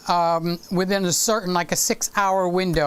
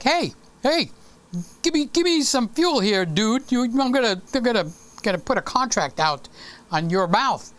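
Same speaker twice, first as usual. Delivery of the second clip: demanding body, higher and pushy; the hungry body demanding fuel like a mobster; body's voice; 3 semitones higher than usual. Acted. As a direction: play a hungry body shaking you down. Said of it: "the body goes like".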